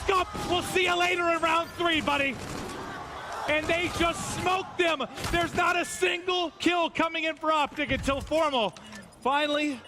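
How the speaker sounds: high, excited live call; esports caster. Acted.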